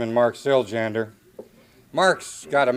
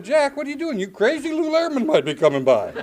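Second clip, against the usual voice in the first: much higher, hammed-up alarm; mock panic; 13 semitones higher than usual. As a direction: squeal it like you're scared to death. Retold panic at the wheel.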